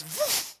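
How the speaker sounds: the tempter vanishing in a puff; airy little vanishing sound; fleeing whoosh. Retold or imitated. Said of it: "o tratonista faz".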